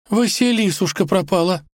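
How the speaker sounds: high, whimpering auntie voice; tearful high lament; sobbing. A narrator's reading. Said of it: "всхлипнула тётя Дуня".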